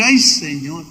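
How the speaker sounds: soft, low "Ay, Señor" to himself; dismayed sigh. Retold or imitated. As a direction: sigh it under your breath. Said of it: "yo dije". (suspiro bajo y suave)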